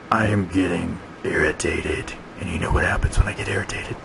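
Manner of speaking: soft, menacing dinosaur grumble; Big Mike. Acted. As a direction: quietly warn the boss you are irritated. Big Mike says he is irritated.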